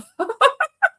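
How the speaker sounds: high-pitched, breaking-up laugh; real laugh. Real, not acted. Speaker cracking up after telling about letting them go, high laugh. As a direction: genuine laugh running up high.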